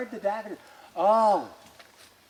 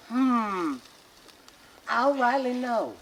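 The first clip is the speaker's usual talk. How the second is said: put-on old grandmother voice; 5 semitones higher, slower than usual.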